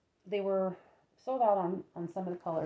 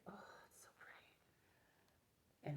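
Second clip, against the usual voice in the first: whispering; 22 dB quieter, much slower than usual. Real, not acted. Admiring yarn softly.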